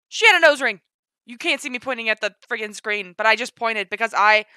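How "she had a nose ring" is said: loud, high exclamation; excited outburst. Real, not acted. Pointing out the nose ring, excited outburst. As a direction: cry it out, thrilled. Repeats the shocked discovery.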